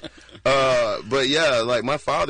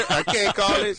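high, laughing repeat; 10 semitones higher than usual. Real, not acted. To the host, laughing as he repeats the phrase. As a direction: laugh through the catchphrase.

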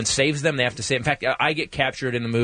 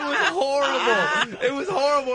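mock wail; 12 semitones higher than usual. Comedian reliving humiliation before the crew, mock wail. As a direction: wail it high as if near tears.

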